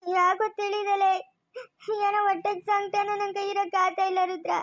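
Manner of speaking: crying, drawing out the first words; tearful anguish. Acted. Mourner telling Rudra of unbearable distress, crying. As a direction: plead through tears. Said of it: "ಅಳ್ಬೇಡ ಕಲ್ದಿರೋ ಶಿವ".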